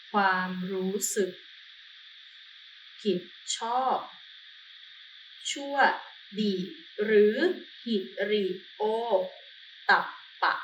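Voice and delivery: ความรู้สึกผิดชอบชั่วดี with long pauses. Shown as a demonstration. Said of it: "slow spelled-out definition".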